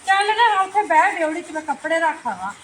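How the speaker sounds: high, loud brush-off; loud shooing. Acted. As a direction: shoo her away loudly. Shooing the neighbor off loudly.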